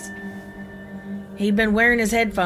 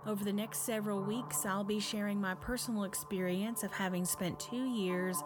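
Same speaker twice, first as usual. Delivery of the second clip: spooky host voice; 3 semitones higher, 15 dB quieter than usual. Acted. Spooky podcast host intro.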